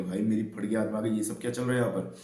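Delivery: ordinary talk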